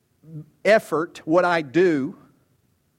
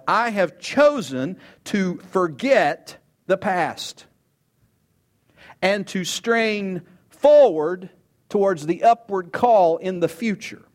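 slow resolute Paul; 3 semitones higher, 3 dB louder, slower than usual. Acted. Preacher voicing Paul's resolve.

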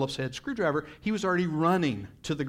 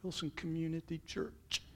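hushed naming; 13 dB quieter than usual. Retold or imitated. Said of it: "and I said".

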